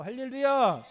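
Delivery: hallelujah rung out loud to the hall; loud hallelujah call. Real, not acted. Preacher calls the congregation to answer, loud hallelujah. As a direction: call it out and let it fall.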